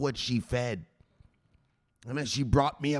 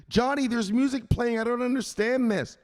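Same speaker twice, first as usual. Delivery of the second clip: confused mom; 10 semitones higher than usual. Acted.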